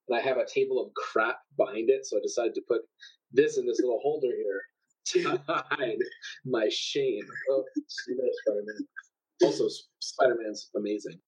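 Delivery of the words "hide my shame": ordinary delivery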